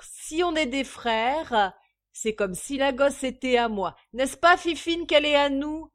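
loud, high-pitched tipsy declarations; exuberant drunkard. A narrator's reading. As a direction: proclaim it like a beaming drunk. Drunk man's exuberant declarations of brotherhood. (ivrogne exubérant, voix forte)

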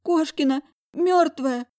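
high, slow horrified cry; girl crying out that the woman is dead; terrified cry. A narrator's reading. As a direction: blurt out the horror. Girl terrified, finds body.

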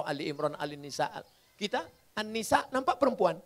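ordinary delivery

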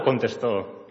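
ordinary talk

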